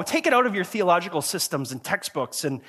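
usual speaking voice

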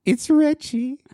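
put-on very high, squeaky kid calling Mom; exaggerated high-pitched phone voice. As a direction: mimic a teenager's falsetto plea to Mom. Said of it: "with that high-pitched voice".

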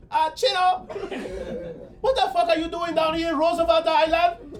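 high, loud laughing Barbados accent. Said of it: "You gotta do the Barbados accent".

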